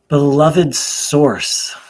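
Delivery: slow, loud call to the divine; prayer invocation. Real, not acted. Meditation leader calls on the Source to open a prayer, slow invocation. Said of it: "start with sort of an invocation or a prayer".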